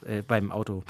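ordinary delivery

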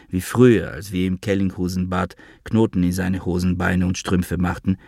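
ordinary delivery

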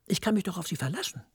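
ordinary talk, nothing marked

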